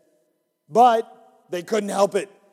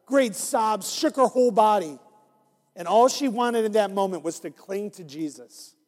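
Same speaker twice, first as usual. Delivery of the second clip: usual speaking voice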